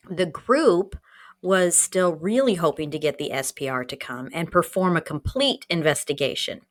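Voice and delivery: normal talking voice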